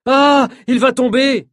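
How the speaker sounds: cri aigu et lent, bien plus haut; elle voit qu'il va tomber, crie aigu; cri de peur. A narrator's reading. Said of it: "crie Nina". (Nina crie, effrayée, voix très haute)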